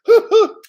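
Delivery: sudden high loud laugh of delight; burst of laughter. Real, not acted. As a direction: laugh out, overjoyed. Giddy over Ripple news.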